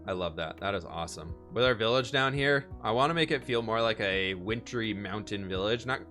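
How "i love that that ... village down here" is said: ordinary delivery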